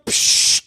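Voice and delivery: short hissed "psh" of a nasal spray; unvoiced burst of air; spray sound. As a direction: imitate a squirt of spray.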